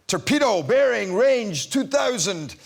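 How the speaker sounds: slow, clipped military report; naval officer line. Retold or imitated. Recalling her Bond line, slow naval report. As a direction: read it out like a quartermaster on the bridge. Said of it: "So my lines were".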